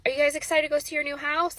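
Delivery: excited question. Retold or imitated. Bishop asking kids excitedly.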